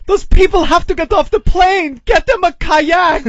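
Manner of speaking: high frantic yell; panicked shout. Retold or imitated. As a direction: shriek it like the plane's going down. Reading listener feedback in panic.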